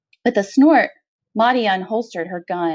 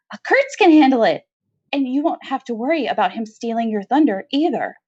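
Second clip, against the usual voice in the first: hopeful prisoner pitching a plan; nervous convict; 6 semitones higher than usual. Acted. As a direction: blurt the idea out in a rush of nerves. Maudie's nervous sudden idea.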